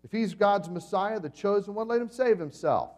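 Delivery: sneering taunt. Acted. Religious rulers taunting Jesus on the cross, sneering. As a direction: jeer up at the man on the cross. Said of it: "They sneered at him".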